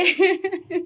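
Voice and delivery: high, loud giggle; amused laugh. Real, not acted. Recalling boys bringing water to douse her, amused laugh. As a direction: giggle while telling the prank.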